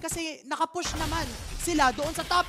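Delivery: high, urgent shoutcast; excited play-by-play. Real, not acted. Casters call Zone's kick on the enemy, excited play-by-play. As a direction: voice lifted as the kick lands.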